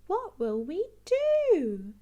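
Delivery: high, loud and slow fretting; Mummy Pig at a loss over the flooded house, anxious; worried Mummy Pig. Acted. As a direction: plead it like a flustered mum. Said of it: "says Mummy Pig".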